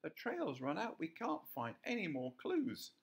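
high, squeaky little rabbit. A narrator's reading. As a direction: pitch way up as a small rabbit. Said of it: "rabbits ran up to him".

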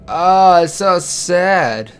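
high, drawn-out dismay, loud at first; saddened wail. Real, not acted. Player learns of a lost child's spirit, saddened wail. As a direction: draw it out in sorry sympathy. Reacting to dead child's story.

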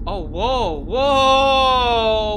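loud drawn-out alarmed whoa; startled whoa. Real, not acted. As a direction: recoil in shock. Startled by twitching figure.

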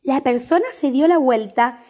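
plain reading voice

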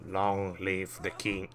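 slow, menacing film-villain quote; Lion King impression. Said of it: "Lion King on me".

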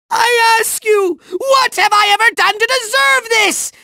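high, aggrieved villain outburst; theatrical indignant fury; furious rage. Acted. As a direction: wail at the injustice of it. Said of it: "conquer my furious rage".

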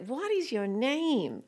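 slow, high, drawn-out question; baffled Americans. Retold or imitated. Americans asking a small boy his name, slow and baffled. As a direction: imitate someone straining to understand, spelling it out slowly. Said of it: "couldn't understand his Australian accent".

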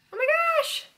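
excited squeal. Real, not acted. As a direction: squeal at how cute it is. Reading a cute card back.

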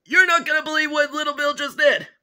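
high-pitched, breaking shocking news; scandalized report. Acted. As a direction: blurt it out, appalled.

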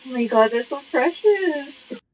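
ordinary delivery

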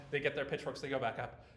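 normal talking voice

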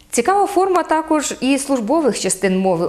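ordinary delivery

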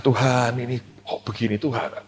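quiet lament. Retold or imitated. Hurt pastor complains to God.

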